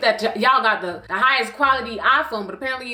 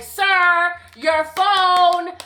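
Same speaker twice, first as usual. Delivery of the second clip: raised singsong call to someone leaving; higher, sustained mock hailing; 5 semitones higher, 3 dB louder, much slower than usual. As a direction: call after a driver, drawing out each word. Calling out at departing car window.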